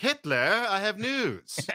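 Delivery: high, slow mid-Atlantic officer's announcement; hammed-up mid-Atlantic delivery of a first line; mock mid-Atlantic accent. Recalling his first film line, in a hammed-up mid-Atlantic accent. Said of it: "mid-Atlantic accent where they don't want".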